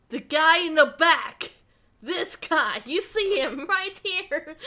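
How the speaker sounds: loud, high exclamation; excited outburst. Real, not acted. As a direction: point him out with real excitement. Excitedly points out background character.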